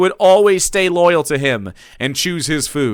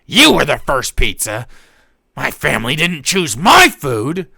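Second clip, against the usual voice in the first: shocked villain voice; 5 dB louder than usual. A narrator's reading. Villain realizes his defeat.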